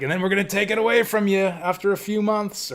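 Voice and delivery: high, sneering company spokesman; mock the smug company line; mocking corporate voice. Mocking Nintendo's policy.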